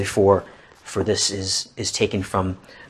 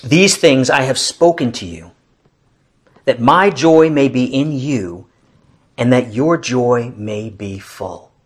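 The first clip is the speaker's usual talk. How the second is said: loud, slow, measured declaration; scripture proclamation; 5 dB louder, slower than usual. Acted. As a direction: proclaim the verse slowly, with weight. Solemnly proclaims a scripture verse.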